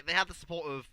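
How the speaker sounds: ordinary talk, nothing marked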